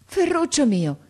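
high, loud cry of alarm; astonished, uneasy exclamation. A narrator's reading. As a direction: cry out to him in sudden worry. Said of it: "esclamò la nonna, stupita e inquieta". (esclamazione alta, stupita e inquieta)

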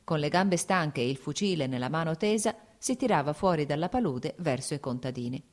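ordinary delivery

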